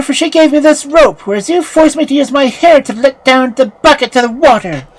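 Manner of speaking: high, loud character voice; story character refusing an order. Acted.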